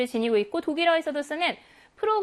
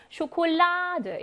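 쇼콜라드 slowly, with a long raised 라; slow demo of 쇼콜라드, weight on 라; marked word stress; 4 semitones higher, much slower than usual. Shown as a demonstration. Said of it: "여기에 강세 있습니다".

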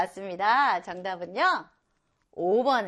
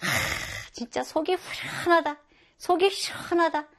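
higher, satisfied sigh after cold water; refreshed relief; 7 semitones higher than usual. Acted. A drinker after cold water, higher and relieved. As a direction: gulp the water and savor it. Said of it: "시원한 물을 캬 하고 먹었을 때".

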